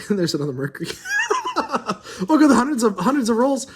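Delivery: laughing in disbelief; giggly amazed laugh. Real, not acted.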